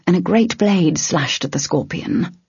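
ordinary delivery

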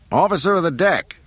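loud call across the hangar; yell. Acted. Han yelling for the deck officer, loud. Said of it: "Han yelled".

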